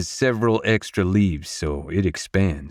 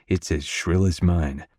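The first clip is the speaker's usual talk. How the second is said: ordinary delivery, nothing marked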